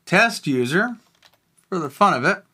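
usual speaking voice

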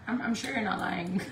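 ordinary talk, nothing marked

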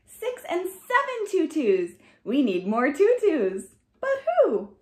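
ordinary delivery, nothing marked

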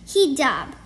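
ordinary delivery

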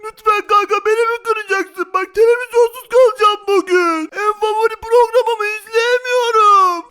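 loud, high whiny plea; pleading. Acted. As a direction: beg a friend, stretching the last word. Tarık begs for a repair.

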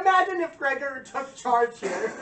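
hammed-up fanboy voice; mock high voice. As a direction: squeak it out in a put-on voice. Voicing a figure, high.

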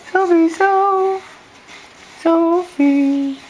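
very high, slow drawn-out call. Real, not acted. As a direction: stretch her name high and slow. Calling baby.

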